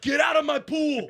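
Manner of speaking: mock Carl yell. Quoting Carl, loud with laughter.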